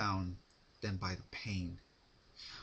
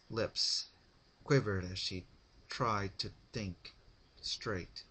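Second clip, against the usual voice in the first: ordinary delivery, nothing marked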